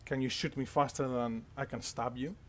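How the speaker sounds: low, soft challenge; stern guard. Acted. Spear guard challenges the gunman, stern and hushed. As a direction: dare them quietly and sternly. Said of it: "he seems very, um, stern".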